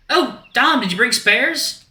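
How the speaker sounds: very high, loud, hopeful asking; eager Axel voice. Acted. Axel asks Dom for spare sandwiches, high eager voice. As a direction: ask excitedly in a high voice.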